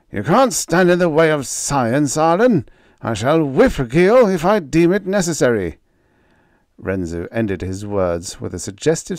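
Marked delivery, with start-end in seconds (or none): 0.1-5.8 loud, much higher arrogant retort; defiant. A narrator's reading. Scientist insisting he will whip the slave, loud arrogant defiance. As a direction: lecture him with contempt. Scientist defies captain's orders.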